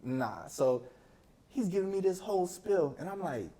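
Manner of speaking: ordinary talk, nothing marked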